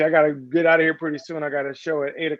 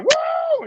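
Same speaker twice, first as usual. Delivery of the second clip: high-pitched "woo" of a screaming player; imitated game scream. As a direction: mimic a player whooping at the game, voice leaping way up. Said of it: "be at the game screaming like".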